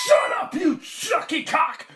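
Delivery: high, loud angry farmer shout; cried out. A narrator's reading. Farmer yells at cockerel from bedroom, high shout. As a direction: bellow furiously from a window. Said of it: "cried the farmer from his bedroom window".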